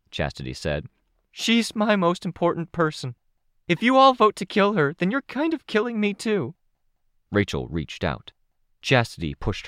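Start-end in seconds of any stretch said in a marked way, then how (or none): 1.3-6.5 put-on a much higher girl's voice, desperate and pleading; a teenage girl fighting back tears to defend someone she loves; upset teenage girl. A narrator's reading. Chastity's voice, pleading.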